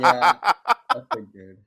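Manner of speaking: real laughter through the words; laughing reply. Real, not acted. Player reacts to the creature refusing to go first, laughing.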